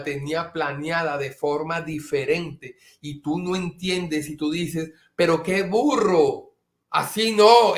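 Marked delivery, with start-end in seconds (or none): 5.1-7.8 loud, slow insistent scolding; stubborn outburst. Retold or imitated. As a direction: insist loudly it's your way. Imitating a stubborn person. (gritando testarudo, lento y fuerte)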